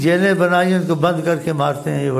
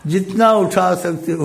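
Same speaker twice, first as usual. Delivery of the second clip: generous urging; 4 semitones higher than usual. Retold or imitated. Repeats the host's generous offer.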